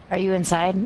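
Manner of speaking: normal talking voice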